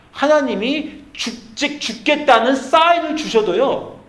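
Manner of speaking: high, loud, slow frantic plea to God; desperate pleading. Acted. 절박한 사람이 하나님께 매달리며 필사적으로 외치는 간구. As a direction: beg God wildly, dragging the words. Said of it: "막 미친 듯이 간구하는 겁니다".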